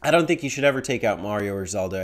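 usual speaking voice

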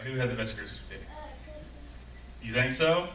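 ordinary talk, nothing marked

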